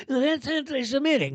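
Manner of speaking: high-pitched mocking complainer voice; mock objector. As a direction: put on a whiny caricature of someone objecting.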